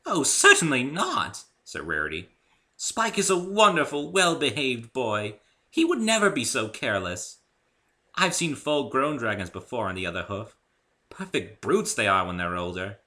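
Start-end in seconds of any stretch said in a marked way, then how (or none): 0.1-1.4 higher, louder, elegant lady's voice; a gracious unicorn hairdresser; refined Rarity. A narrator's reading. Rarity offering Dave a haircut, high and refined. 2.8-13.0 higher, louder, elegant lady's voice; a gracious unicorn hairdresser; refined Rarity. A narrator's reading. Rarity offering Dave a haircut, high and refined.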